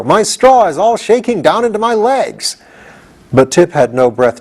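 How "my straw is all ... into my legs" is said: high, a touch alarmed straw man; lightly voiced frantic scarecrow; distressed scarecrow. Scarecrow shaken on wild ride.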